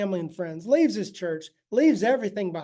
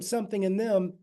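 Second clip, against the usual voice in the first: usual speaking voice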